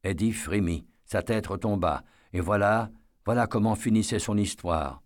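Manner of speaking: ordinary delivery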